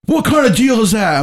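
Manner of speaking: high-pitched mock outrage; retold yelling. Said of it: "he's yelling and screaming".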